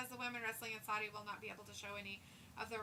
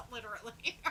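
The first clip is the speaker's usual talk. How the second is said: ordinary talk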